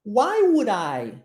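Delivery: "Why would I?" said slowly, D flowing into I; slow linked demo. Shown as a demonstration. Teacher modelling the link in "Why would I" slowly. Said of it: "Olha a junção do D com I".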